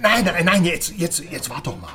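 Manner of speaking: urgent. Acted. Stopping him from leaving.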